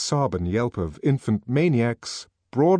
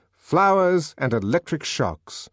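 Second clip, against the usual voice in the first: usual speaking voice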